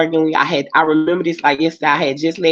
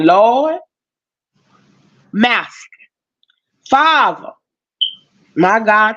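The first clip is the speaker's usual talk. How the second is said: desperate prayer; 2 semitones higher, slower than usual. Retold or imitated. Praying to God to get out of danger, desperate pleading. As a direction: beg God with each word. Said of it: "I was like".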